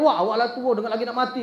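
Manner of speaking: put-on a youth insulting an old muezzin, angry; angry rebuke. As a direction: snap at the old man rudely. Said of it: "Marah dia".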